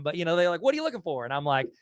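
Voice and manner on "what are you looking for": retold question in a less Southern accent; non-Southern retelling. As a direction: ask it higher, dropping the Southern accent. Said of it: "I'm not saying everybody talks like me".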